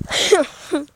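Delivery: ordinary delivery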